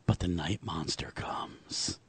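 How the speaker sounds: put-on slow, hushed, spooky child whisper; daughter's creepy whisper of the night monster. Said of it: "That's exactly how she'll say it".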